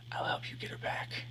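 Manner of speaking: unvoiced ghostly whisper; eerie whisper. A narrator's reading. Reflection whispers to him to win her back. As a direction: breathe it like a voice inside his head.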